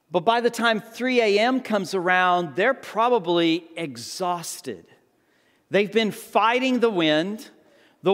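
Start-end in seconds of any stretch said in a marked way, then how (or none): none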